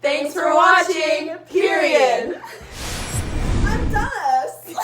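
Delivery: loud, drawn-out shout; shouted sign-off. Acted. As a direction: belt out the sign-off slowly.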